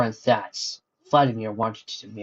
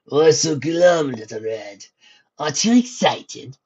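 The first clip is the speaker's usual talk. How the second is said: taunting; 4 semitones higher, 7 dB louder, slower than usual. Acted. Villain taunting his reflection.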